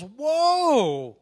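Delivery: loud, high, wide-eyed "Whoa!"; a little kid seeing a rocket for the first time; imitating a child's loud, high gasp of wonder; kid's amazed whoa. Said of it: "the little kid looks at it and goes".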